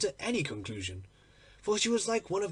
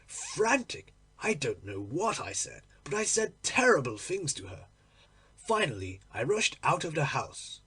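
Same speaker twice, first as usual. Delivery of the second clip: ordinary delivery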